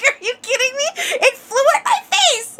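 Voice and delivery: high, laughing incredulous reply; laughing disbelief. Retold or imitated. To her husband asking if she's sure, laughing in disbelief.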